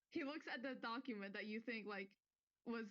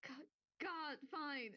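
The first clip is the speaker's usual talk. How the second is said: slightly higher, exasperated give-in; grudging muffled assent; 4 semitones higher, much slower than usual. Acted. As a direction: give up with a groan. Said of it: "more muffled voice say".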